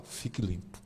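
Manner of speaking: gentle command. Retold or imitated. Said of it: "Depois é que ele fala".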